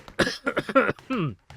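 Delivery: choking cough mid sentence. Real, not acted. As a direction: cough through the outro. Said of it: "I'm choking on my coffee".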